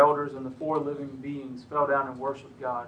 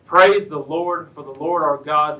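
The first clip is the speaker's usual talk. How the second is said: crowd's shout; 9 dB louder than usual. A narrator's reading. Reader voices a vast crowd praising God, loud proclaimed shout. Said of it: "like the shout of a vast crowd".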